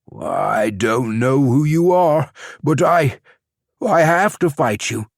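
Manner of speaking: strained defiance. Acted. Captured champion defiant, torn.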